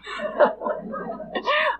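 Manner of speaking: breathy high-pitched laugh; high laughter at the memory. Real, not acted. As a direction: burst out laughing. Laughs at own joke.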